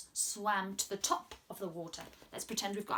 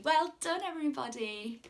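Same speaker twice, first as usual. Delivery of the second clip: ordinary delivery, nothing marked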